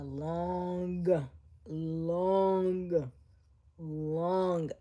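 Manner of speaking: slow, stretched out; drawn out. Real, not acted. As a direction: stretch out every long. Drawn-out long long long.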